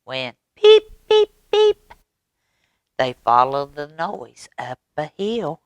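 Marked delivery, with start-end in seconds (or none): 0.6-1.9 tiny high-pitched peep calls. A narrator's reading. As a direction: chirp like a spring peeper. Frog peeping in the distance.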